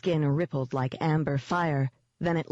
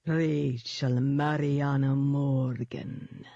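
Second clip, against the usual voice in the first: slow, drawn-out demon dog purr; 3 semitones lower, much slower than usual. A narrator's reading. Demon dog names its prey, honey-sweet and slow. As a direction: savour the full name, dark and sweet.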